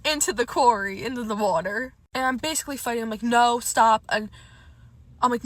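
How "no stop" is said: retold shout, sharply louder; loud protest. Fighting the date at the quarry edge, loud protest. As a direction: fend someone off with a loud refusal.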